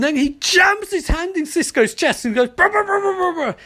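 high-pitched, dramatic burst into a buzzing noise; animated storyteller, pitch jumping up; excited retelling. Retold or imitated. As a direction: act out the blow with rapid rolled brrs. Excited retelling with imitated vibrating noise.

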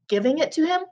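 mildly astonished, voice lifting at the end; a touch of a gasp; gasped disbelief. A narrator's reading. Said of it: "gasped Grandpa Joe".

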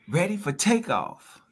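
usual speaking voice

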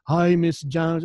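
hammed-up bad Southern drawl; terrible Southern accent. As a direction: put on a clumsy, overdone Southern accent. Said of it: "He tried to do a Southern accent".

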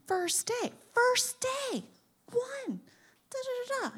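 high, soft mimicked squabble; heated conversation overheard. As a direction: mime a distant argument in a high little voice. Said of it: "It's a heated conversation".

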